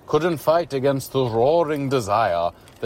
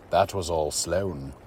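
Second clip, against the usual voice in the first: usual speaking voice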